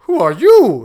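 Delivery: loud, alarmed cry; startled exclamation. Acted. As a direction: jump back in fright. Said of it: "exclaimed his highness".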